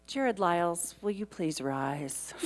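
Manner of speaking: normal talking voice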